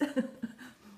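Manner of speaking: short, breathy chuckle; amused laugh. Real, not acted. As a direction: laugh lightly after teasing a friend. Jokes about slowing down.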